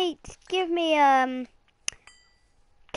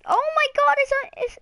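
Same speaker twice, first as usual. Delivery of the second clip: ordinary talk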